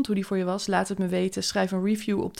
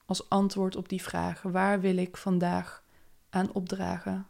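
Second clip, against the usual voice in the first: calm, unhurried meditation voice; guided meditation. Acted. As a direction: guide them gently into the day. Leads a morning meditation. (rustige meditatiestem)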